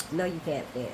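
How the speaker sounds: quiet firm. Retold or imitated. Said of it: "And Tracy reminds him".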